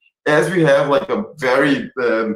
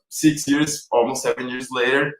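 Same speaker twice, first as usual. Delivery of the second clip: ordinary talk, nothing marked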